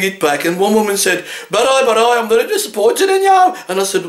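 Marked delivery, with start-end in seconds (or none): 1.5-3.5 high, prim voice of a let-down customer; indignant customer. Retold or imitated. Disappointed woman scolding the butcher, high prim complaint. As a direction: play her as wounded and prim.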